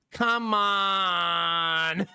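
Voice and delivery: long, slow exasperated plea; drawn-out pleading. Real, not acted. Streamer protesting to chat, exasperated and drawn out. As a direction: stretch it right out like a whiny protest. Streamer groaning in mock despair.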